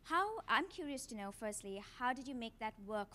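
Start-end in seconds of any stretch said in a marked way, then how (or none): none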